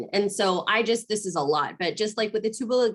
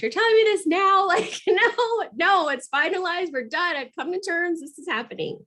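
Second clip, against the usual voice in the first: high-pitched mock protest; retold exasperation; 11 semitones higher, 3 dB louder than usual. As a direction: push back in a high, insistent voice. Acted reply in a disagreement.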